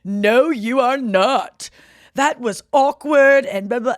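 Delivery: slow, loud mock verdict; hammed-up judge, drawn out and loud; mean judge. Judge putting down the new dancer, mock disdain.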